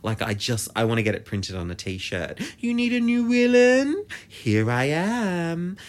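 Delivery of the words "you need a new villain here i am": put-on high, loud European-accented villainess, ending drawn out; hammed-up European accent, slow on the last words; imitated European accent. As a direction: announce yourself grandly in a mock European accent. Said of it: "But in her accent".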